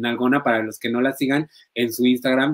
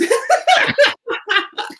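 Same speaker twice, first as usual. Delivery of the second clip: squealing burst of laughter; high shrieking laugh; 4 dB louder than usual. Real, not acted. Laughing at his own English, high squeal. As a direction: let a high laugh escape.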